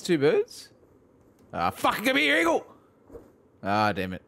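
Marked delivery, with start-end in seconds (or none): 1.5-2.6 high, fast startled yelp; excited yelp. Real, not acted. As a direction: blurt it out in a rush. Startled by a creature.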